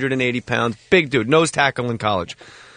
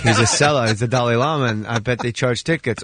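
usual speaking voice

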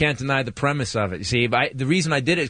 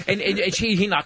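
ordinary delivery

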